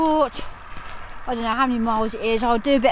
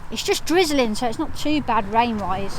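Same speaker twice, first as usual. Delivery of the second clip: usual speaking voice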